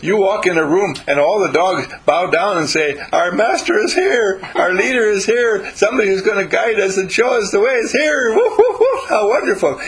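high, loud gushing dog voices; bow down and gush like adoring dogs; excited dogs. Retold or imitated. Dogs hailing their master, high and loud.

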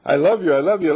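loud, high gushing "I love you"; loving coo. Shown as a demonstration. Gushing love to a seed in the dirt, loud and high.

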